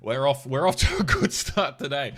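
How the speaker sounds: loud laughing exclaim. Real, not acted. Laughing at a bad start.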